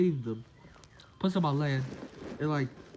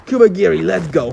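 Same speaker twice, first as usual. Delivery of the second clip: a much louder call to retreat; 13 dB louder than usual. Retold or imitated. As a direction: yell it across the room to your partner. Villain calls partner to retreat.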